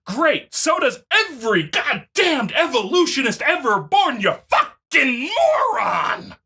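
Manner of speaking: normal talking voice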